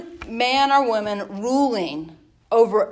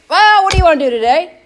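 louder, quicker mock monarch asking; carefree king; 6 semitones higher, 10 dB louder, faster than usual. Preacher mocking a king with no plan, loud and quick. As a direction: toss it off like a lazy king. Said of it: "if I could do a British accent".

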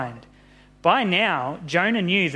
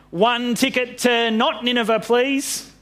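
high-pitched storybook character voice; acted ticket buyer; 7 semitones higher than usual. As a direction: pipe up as if at a ticket counter. Jonah buying ticket in story.

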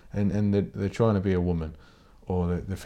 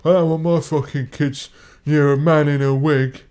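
higher, louder sneering put-on voice; mock bigot voice; 7 semitones higher, 8 dB louder than usual. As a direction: mimic the outraged parent, loud and high. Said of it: "everyone's like".

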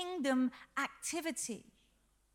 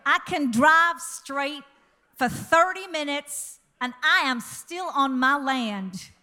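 put-on loud, slow boastful American accent; 8 dB louder than usual. American rancher brags about his huge ranch, loud slow American accent. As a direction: brag about the land in a big American voice. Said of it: "Do you like my accent?".

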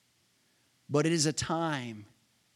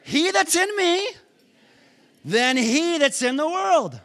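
preaching at full force; 12 semitones higher, 6 dB louder than usual. Shown as a demonstration. Preacher declaring the verse to the congregation, shouting. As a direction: hammer each phrase out. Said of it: "Let's say it again".

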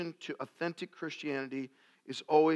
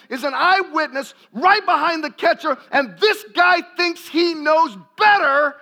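preaching at full force; 10 semitones higher, 10 dB louder than usual. Acted. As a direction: preach it hard to the whole room. Sermon builds to full force.

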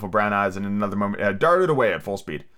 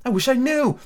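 wistful Alice; 13 semitones higher than usual. A narrator's reading. Alice wistfully answers the fawn.

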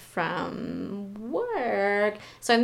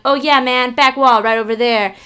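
louder, breezy oh yeah man; mock casual loud directions; 4 semitones higher, 11 dB louder than usual. Telling the professor where the tassels are, breezy and louder. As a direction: toss off the directions like a helpful clerk.

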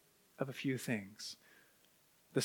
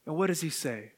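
ordinary delivery

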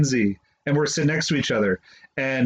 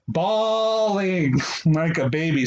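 normal talking voice

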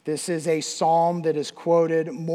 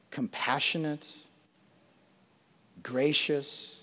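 word by word with long pauses, sinking softer and lower; reverent scripture meditation; 4 semitones lower, 5 dB quieter, slower than usual. Real, not acted. Preacher savors God's love to him, hushed reverent recitation. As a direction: let each word settle, fading almost to nothing. Dwells slowly on the verse.

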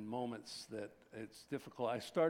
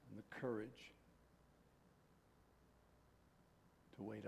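soft, quiet prayer voice; hushed pastoral prayer; 2 semitones lower, 7 dB quieter than usual. Acted. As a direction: pray gently over the congregation, trailing off. Closing prayer before the greeting.

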